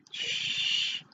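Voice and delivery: a soft, cross shush. Acted. Moonface hushing the bears, crossly. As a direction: hush them, annoyed. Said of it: "said Moonface crossly".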